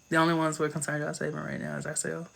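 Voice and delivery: lightly voiced Piper, hushed and fast. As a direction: slip lightly into Piper's quick, hushed delivery. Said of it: "I just said that in the Piper voice".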